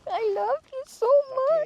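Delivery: high, sing-song cooing at the pet. Real, not acted. Cooing at her dogs.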